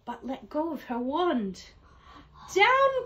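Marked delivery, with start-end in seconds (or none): 2.5-3.0 a high, loud cry of Down; cried out. Acted. Witch shouting to her cat to land. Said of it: "cried the witch".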